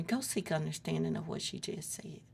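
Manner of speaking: soft unvoiced whisper. God quietly telling her to go back, whispered. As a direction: breathe it like an inner voice.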